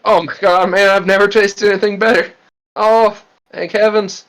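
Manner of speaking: high, rapturous food praise; Graham in ecstasy. Retold or imitated. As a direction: gush over the taste. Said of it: "Graham is just like".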